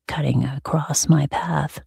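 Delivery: ordinary delivery, nothing marked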